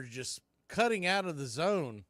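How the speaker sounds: ordinary talk, nothing marked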